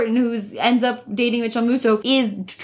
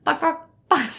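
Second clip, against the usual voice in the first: squawk imitation; 3 semitones higher than usual. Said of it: "Give it a try".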